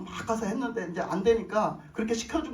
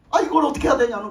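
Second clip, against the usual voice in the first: panicked cry; 3 semitones higher, 8 dB louder, faster than usual. Retold or imitated. Panicked pastor retold.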